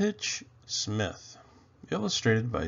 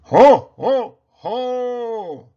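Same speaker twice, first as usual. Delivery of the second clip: booming higher jolly laugh; Santa's ho ho ho; 11 semitones higher, 13 dB louder, much slower than usual. A narrator's reading. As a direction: boom it from the chimney.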